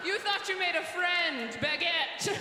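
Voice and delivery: mocking French taunt. Mocking the French person while being wheeled away, high and shouted. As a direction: sneer it over your shoulder. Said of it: "a bigger person wheels me away".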